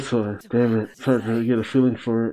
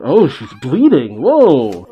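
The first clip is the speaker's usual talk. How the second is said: shocked exclamation; 7 dB louder, slower than usual. Real, not acted. Reacting to bleeding girl on screen.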